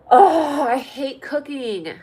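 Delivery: loud groaned Oh, then slowly dragged out; exasperated complaint. Real, not acted. Streamer tells viewers she hates cooking, exasperated. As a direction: groan and gripe, drawing it out.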